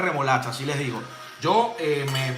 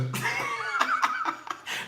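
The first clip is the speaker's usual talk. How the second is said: high burst of laughter; 7 dB quieter than usual. Real, not acted. Laughs at his own mistake.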